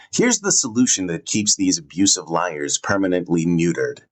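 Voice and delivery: usual speaking voice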